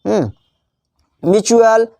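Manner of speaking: ordinary talk, nothing marked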